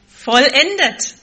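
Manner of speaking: loud, slow, drawn out "vollendet"; preacher hammering home the key word; emphatic preaching. Real, not acted. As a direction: land "vollendet" hard and slow. Sermon climax.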